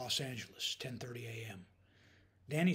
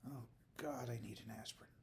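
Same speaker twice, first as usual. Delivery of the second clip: hungover mumble; 2 semitones lower, 9 dB quieter than usual. A narrator's reading. Hungover daughter groaning to herself.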